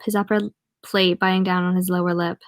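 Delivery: usual speaking voice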